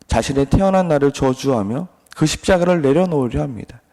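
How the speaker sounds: ordinary talk, nothing marked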